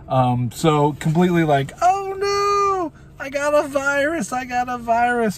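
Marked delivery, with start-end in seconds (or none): 1.8-5.4 very high squeaky mock wail; goofy mock panic. Hit by a computer virus, goofy high-pitched panic. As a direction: play the virus victim in a silly, drawn-out high voice. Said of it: "being goofy".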